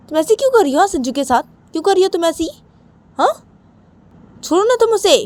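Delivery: building to high-pitched, loud yelling; grandmother's shrill, escalating telling-off; angry scolding. Acted. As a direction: scold the daughter-in-law, climbing into a shout. Grandmother scolds daughter-in-law in story.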